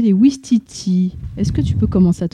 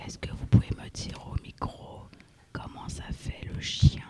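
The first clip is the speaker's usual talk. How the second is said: whispering; 13 dB quieter than usual. Shown as a demonstration. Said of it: "quand on parle tout bas comme ça".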